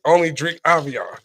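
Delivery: ordinary talk, nothing marked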